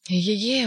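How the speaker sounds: a sudden realisation cry; alarmed exclamation. Acted. Ivan hearing the clock strike, alarmed cry. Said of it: "воскликнул Иван".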